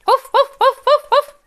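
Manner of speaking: high, quick little woofs; string of short, high-pitched woofs; imitated dog bark. Shown as a demonstration. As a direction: yap like a small dog.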